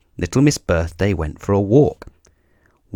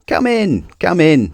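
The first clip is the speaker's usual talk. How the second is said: high, eager voice for a muddled little man; Mister Wrong greeting; 8 semitones higher, 3 dB louder than usual. A narrator's reading. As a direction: welcome her in brightly, high and quick. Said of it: "said Mister Wrong as he answered the door".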